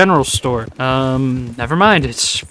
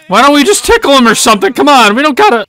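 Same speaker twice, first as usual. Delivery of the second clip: fast high pleading; 9 semitones higher, 4 dB louder, faster than usual. Real, not acted. Pleading amid a fight.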